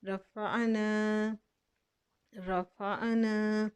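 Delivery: रफ़ाना slowly, the final "aa" kept; recited word. Shown as a demonstration. Teacher recites the word slowly. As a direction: hold the long aa of रफ़ाना. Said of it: "इसको हम साकिन नहीं करेंगे".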